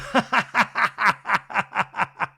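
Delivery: loud, high staccato cackle; hammed-up mock guffaw, burst after burst; exaggerated laugh. Imitating Kyle laughing at his joke.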